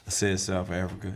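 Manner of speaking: ordinary talk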